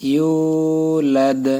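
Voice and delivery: ইউলাদ with a long stretched a, slowly; slow, drawn ইউলাদ; drawn yulad. Shown as a demonstration. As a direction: stretch ইউলাদ one alif. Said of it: "এখানে টান দিবেন".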